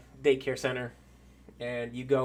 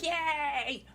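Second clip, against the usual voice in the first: put-on high, drawn-out child cheer; long high-pitched little cheer; cheering kids; 13 semitones higher than usual. Daycare kids hear it is story time, high cheer. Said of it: "They're all like".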